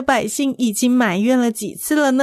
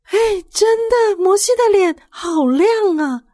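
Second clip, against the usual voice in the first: put-on much higher, louder excited child; amazed child; 11 semitones higher, 3 dB louder than usual. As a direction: squeal in wonder like a child.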